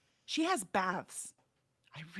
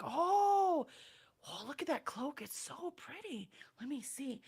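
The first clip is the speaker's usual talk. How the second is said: high "oh" into soft, airy cooing; hushed delight; 6 semitones higher, 6 dB quieter than usual. Acted. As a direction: coo half-whispered over something pretty. Character admiring a pretty cloak.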